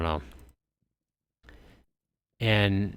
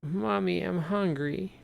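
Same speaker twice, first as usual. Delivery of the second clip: put-on high, soft small-child voice; a hungry little boy whining to his mom; little kid voice; 10 semitones higher, 7 dB quieter than usual. Child tells his mother he is hungry, high and soft. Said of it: "the kid's like".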